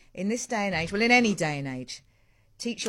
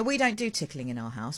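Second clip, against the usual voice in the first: usual speaking voice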